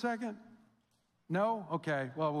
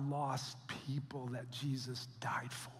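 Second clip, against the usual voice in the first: much softer, hushed whisper; dramatic whisper; 8 semitones lower, 10 dB quieter than usual. Real, not acted. Pastor asking the church about lost people, in a hushed whisper. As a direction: drop to a whisper to make it land.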